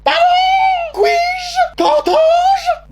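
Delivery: mock-aristocratic shocked cry; outraged surprise. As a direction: recoil in scandalised shock. Mother outraged by slang.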